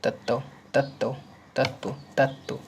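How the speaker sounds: तत्व said slowly, like तत्तो, with a doubled त and a rounded end; teacher slowly repeating तत्व as तत्तो; slow drill. Shown as a demonstration. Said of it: "बोलते क्या हैं इसको".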